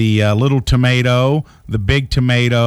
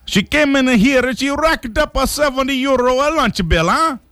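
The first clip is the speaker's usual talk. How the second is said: much higher, hammed-up Italian accent; Giovanni Capelli; 12 semitones higher than usual. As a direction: gripe in exaggerated cartoon Italian. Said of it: "Griped Giovanni Capelli".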